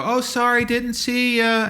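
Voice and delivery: high, drawn-out fake apology; mock apology. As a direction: imitate an oblivious dog owner apologizing. Mimicking off-leash dog owners' excuse.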